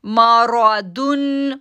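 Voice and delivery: मारादुन chanted very slowly with a heavy रा and a heavy दुन; slow tajweed chant; full-mouthed recitation. Shown as a demonstration. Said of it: "रा को पूरा आवाज से पढ़ेंगे".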